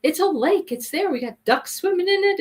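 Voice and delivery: mock-indignant, pitched well up; imitated explanation. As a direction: lightly act him arguing what counts as a lake. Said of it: "he goes".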